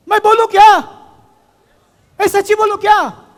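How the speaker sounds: high, loud call to the crowd; hall-filling shout to students. Real, not acted. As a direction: rouse the hall to answer back. Calling out to the audience.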